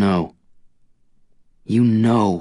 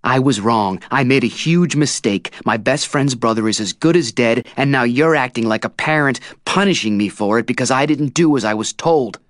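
loud angry shouting; 6 semitones higher, 5 dB louder than usual. A narrator's reading. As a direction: yell at her in frustration. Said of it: "I'm shouting now".

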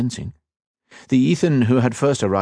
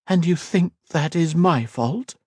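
higher, slow woman's voice; feminine voice; 9 semitones higher than usual. Acted. The elf woman answers Ethan's insult, higher slow feminine voice. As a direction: lift high and ask it slowly.

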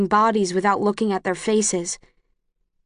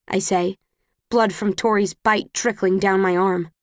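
ordinary delivery

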